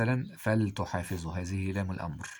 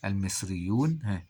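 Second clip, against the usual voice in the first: ordinary talk, nothing marked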